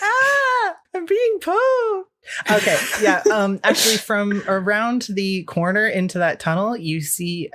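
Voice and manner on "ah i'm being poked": high, squealing mock rat voice; hammed-up high rodent cry; poked rat. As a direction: wail slowly as a poked pet rat. Game master voices the poked rat.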